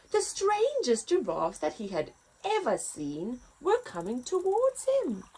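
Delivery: lively picture book read-aloud; story narration. A narrator's reading. Narrator reading to children, lively.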